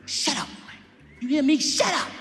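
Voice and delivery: high, forceful church hiss; mother hushing sternly. Retold or imitated. Mother shushes Chris in church, angrily. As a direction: silence the child in a high, sharp voice.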